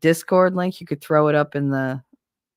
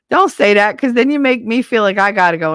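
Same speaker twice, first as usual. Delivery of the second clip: loud protest; 4 semitones higher, 8 dB louder than usual. Real, not acted. Protesting at potty remark.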